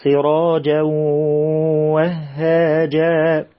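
سراجا وهاجا with the tanween merged into the waw, nasal hum held; merging with ghunna. Shown as a demonstration. Teacher models the merging with ghunna for the children. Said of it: "الإدغام بغنة".

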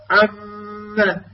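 slow held ghunna on عم; long nasal ghunna. Shown as a demonstration. Teacher holding the nasal hum. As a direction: hum through the mim. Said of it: "الغنة فيها أعلى ما يكون".

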